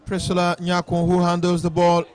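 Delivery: steady match commentary; live play-by-play. Real, not acted. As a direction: call the game as it happens.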